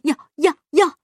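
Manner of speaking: excited miser. A narrator's reading. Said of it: "巴依老爷兴奋地说".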